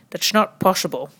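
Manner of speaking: put-on comic Dutch accent refusal. Comedian quoting the phrase heard over and over, Dutch accent. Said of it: "my Dutch accent is so amazing".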